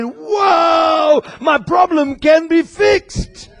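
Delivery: overjoyed it can be fixed; rising joyful whoa; excited relief. Retold or imitated. Retold joyful reaction.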